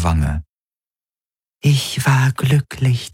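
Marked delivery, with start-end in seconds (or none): 1.6-3.1 a servant spirit bidding her master farewell. A narrator's reading.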